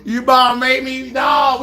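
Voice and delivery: usual speaking voice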